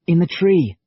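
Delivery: quick, tense direction; urgent. Acted. Liam urgently pointing.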